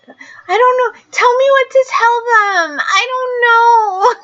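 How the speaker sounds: put-on high, helpless Valley Girl whine; mock-tearful ditzy girl, slowing down; whiny Valley Girl. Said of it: "talking like a Valley Girl".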